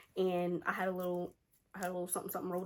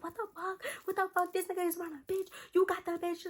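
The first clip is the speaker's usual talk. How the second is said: high, wailing mock sobbing voice; imitated crying; 10 semitones higher, 5 dB quieter than usual. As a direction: imitate a friend in tearful disbelief, pitched way up. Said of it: "She starts crying".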